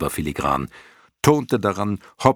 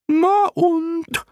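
very high, loud squeak; cheeky clown moth; 5 dB louder than usual. A narrator's reading. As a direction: squeak it back, couldn't care less. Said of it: "sagte eine andere". (freche Piepsstimme, sehr hoch und laut)

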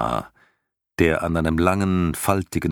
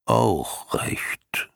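whispering creature; 4 semitones higher than usual. Acted. Morla shrugs it off.

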